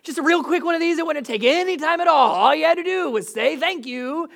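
a hint of comic indignation; mock frustrated. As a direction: play the hurt driver, lightly huffy. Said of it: "I get flustered and frustrated 'cause I'm like".

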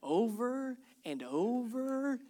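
slow, dragging repetition; drawn out. Acted. Dad sighing over rereading the same book, slow and drawn out. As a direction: stretch each over out wearily. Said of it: "we read this book".

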